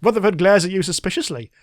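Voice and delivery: usual speaking voice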